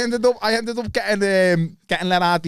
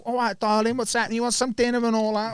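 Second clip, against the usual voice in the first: put-on London accent; 7 semitones higher than usual. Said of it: "The London accent".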